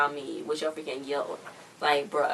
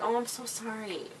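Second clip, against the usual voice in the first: higher, softer pretend sorry; mock apology; 4 semitones higher, 7 dB quieter than usual. Recapping her apology to the others, mock-sorry voice. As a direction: imitate a small, pleading apology. Acted apology in retold scene.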